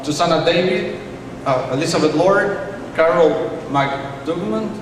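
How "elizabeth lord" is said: ordinary talk, nothing marked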